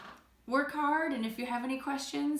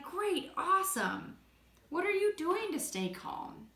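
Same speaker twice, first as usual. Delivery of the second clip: higher, upbeat modelled response; cheerful reply; 7 semitones higher than usual. Shown as a demonstration. Teacher models replying to someone who feels calm, cheerful.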